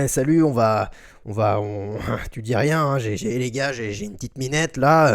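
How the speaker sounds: low, hushed sleazy boss; a lightly voiced creepy old boss. Imitates McMahon to staff.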